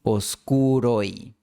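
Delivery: Oscuroi, slow, oi as one glide; oscuroi diphthong. Shown as a demonstration. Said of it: "formando un diptongo oi".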